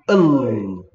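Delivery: 을 slow with the tongue poking out; 을 held long, tongue out like teasing. Shown as a demonstration. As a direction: stick your tongue out on 을. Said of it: "메롱 하는 거야".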